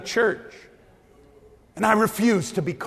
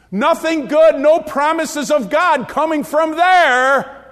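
rising, louder preacher's cry, slowed on the doom lines; preaching at full force; 5 semitones higher, 5 dB louder than usual. Acted. Preacher to his church, building to a loud cry. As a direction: hammer home that they were written off. Preaches the barren couple case.

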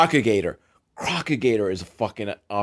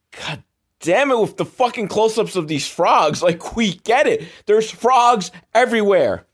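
exasperated rant; 9 semitones higher than usual. Real, not acted. Viewer fed up with the frog close-ups, exasperated outburst. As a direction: vent at the screen, we get it.

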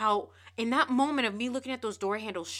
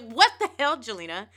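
exasperated dad; 3 semitones higher, 8 dB louder than usual. Retold or imitated. Dad with arms flapping at his crying daughter, loud mock exasperation. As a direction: imitate a father's exploding disbelief.

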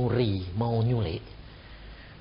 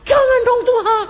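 high, wailing mock sob, louder; hammed-up weeping prayer, much higher; mock crying plea; 6 dB louder than usual. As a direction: imitate relatives begging God through tears. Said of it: "Menangisnya kayak gini".

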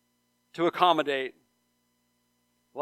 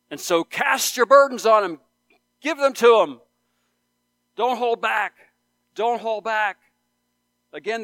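higher, louder, ringing sermon climax; fervent preaching; 6 semitones higher, 6 dB louder than usual. Real, not acted. Pastor urging his congregation, loud fervent preaching. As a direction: drive the promise home to the room.

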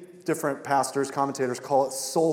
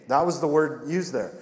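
usual speaking voice

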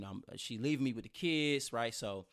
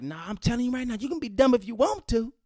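higher, louder mom impression; mother's voice; 11 semitones higher, 7 dB louder than usual. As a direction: insist like a stubborn mother. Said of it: "She like".

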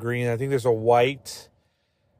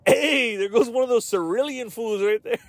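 higher cartoon thug call-out; mock gangster call; 9 semitones higher than usual. Gang member spotting cerulean rival, high mock thug voice. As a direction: shout it out like a hammed-up gangster.

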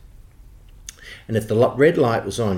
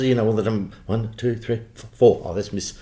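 ordinary delivery

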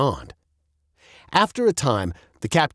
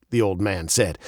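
plain reading voice

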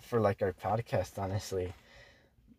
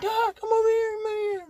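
much higher, pleading, nearly crying; whiny girl voice. Retold or imitated. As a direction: beg him to come over in a high whine. Girl pleading in his story.

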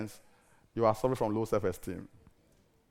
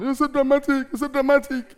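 high, loud teasing singsong; mock the family's complaint, pitched up; 13 semitones higher, 9 dB louder than usual. Family at home teasing the preacher, high and loud mockery. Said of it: "every little night they say".

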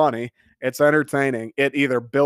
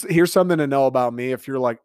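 ordinary talk, nothing marked

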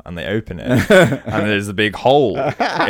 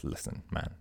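whispered, unvoiced; hushed; 20 dB quieter than usual. Mike confiding in his best friend, whisper. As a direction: lean in and whisper it. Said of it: "and says".

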